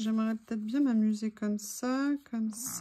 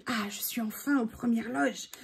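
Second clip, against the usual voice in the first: dog's thoughts; 4 dB quieter than usual. Pretend. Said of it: "je le sens tellement content".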